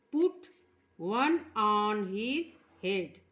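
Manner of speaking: very slow, word by word; pronunciation read-through. Shown as a demonstration. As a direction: spell out each word slowly for children. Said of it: "आइए देखते हैं इसका उच्चारण". (धीरे-धीरे उच्चारण)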